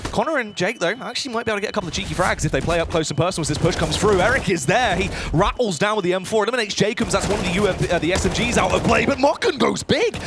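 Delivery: excited high play-by-play; esports caster. Real, not acted. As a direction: build the hype with every kill. Caster calls live round.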